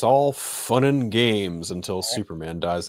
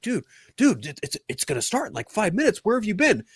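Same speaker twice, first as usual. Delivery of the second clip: anxious friend; 12 semitones higher than usual. Retold or imitated. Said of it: "He's like".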